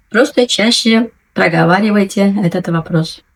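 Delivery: ordinary delivery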